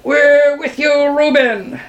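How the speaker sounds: slow, high, loud chorus cry; high-pitched birds pledging support; two voices in unison. A narrator's reading. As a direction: draw it out high, rallying. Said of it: "replied in unison".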